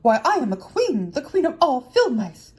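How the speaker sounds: loud, slow, emphatic self-proclamation; indignant mouse queen. A narrator's reading. Mouse queen correcting the woodman, indignant.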